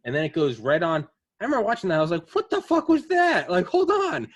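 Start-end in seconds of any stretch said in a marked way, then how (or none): 2.3-4.3 much higher and louder, slow disbelief; mock shocked reaction to a strange film scene; astonished. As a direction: replay the baffled outburst in full. Retelling his reaction watching film.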